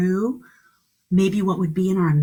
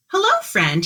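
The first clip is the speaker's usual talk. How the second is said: friendly greeting; 11 semitones higher, 4 dB louder than usual. A narrator's reading. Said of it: "he said to the raccoon".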